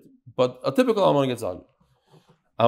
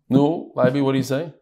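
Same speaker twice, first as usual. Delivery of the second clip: normal talking voice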